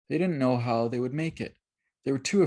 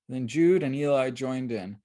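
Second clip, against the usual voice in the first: usual speaking voice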